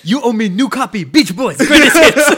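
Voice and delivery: loud joking imitation of a Chinese accent; mock Chinese accent. Friend riffing on the restaurant host, mock Chinese accent. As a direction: join in with a hammed-up Chinese accent. Joins in mimicking the host's accent.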